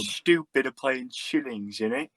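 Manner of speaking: hammed-up, badly faked British accent. Teasing friends over shillings, terrible British accent. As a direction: put on a terrible British accent, pitch leaping up on the tag. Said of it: "a British accent very terribly".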